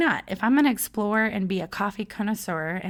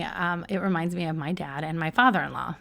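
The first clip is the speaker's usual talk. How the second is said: ordinary talk, nothing marked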